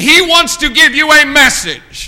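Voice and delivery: high, loud pulpit proclamation; full-force preaching. Real, not acted. Preacher announcing God's message to the congregation, full-force preaching. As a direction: ring it out to the congregation.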